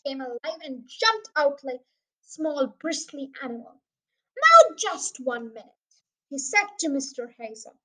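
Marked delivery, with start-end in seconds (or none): 4.4-5.9 lightly voiced slow, firm sergeant; affronted police sergeant. Bristling sergeant stops Mister Hazel poking him, firmly affronted. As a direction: halt him with slow, loud authority.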